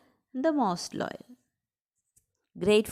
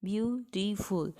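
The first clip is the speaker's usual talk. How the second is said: slow, softer "Beautiful" in three separate beats; split into syllables; 7 dB quieter, slower than usual. Shown as a demonstration. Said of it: "how many syllables are there".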